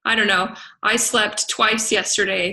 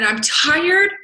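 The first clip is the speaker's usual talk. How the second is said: higher, louder outburst; fired-up declaration; 8 semitones higher, 5 dB louder than usual. Retold or imitated. Coach retelling telling her mentor she is tired of losing, loud and fired up. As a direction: burst out with it, fed up. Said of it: "I said".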